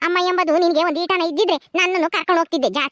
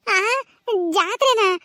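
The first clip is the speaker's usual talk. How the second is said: high crying lament, slowed; 5 semitones higher than usual. Acted. Sister crying to the old mother about the jailed brother, weeping. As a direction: wail it out through tears.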